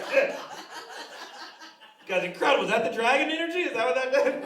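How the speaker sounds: amazed. Retold or imitated. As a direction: marvel out loud, half laughing. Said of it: "You're like".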